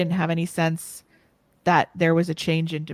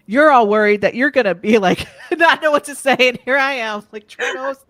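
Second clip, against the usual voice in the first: laughing; 4 semitones higher, 9 dB louder than usual. Real, not acted. Host laughs at herself.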